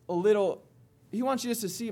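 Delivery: usual speaking voice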